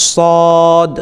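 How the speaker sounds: সদ held long on a steady note; drawn-out letter name. Shown as a demonstration. Teacher demonstrating the length rule, stretched সদ. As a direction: stretch the vowel of সদ one alif. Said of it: "এক আলিফ পরিমাণ টান দেবেন".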